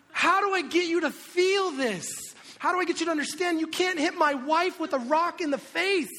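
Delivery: high, loud, hammed-up parental yelling; outraged scolding. Dad scolding toddler in time-out, loud outraged yelling. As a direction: shout in exasperated disbelief at a small child.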